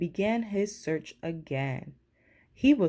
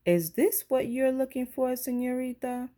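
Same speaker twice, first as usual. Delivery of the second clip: lightly voiced manager, higher; a hint of a friendly manager; manager's voice; 7 semitones higher than usual. Manager holding up the bear for Lisa, lightly voiced.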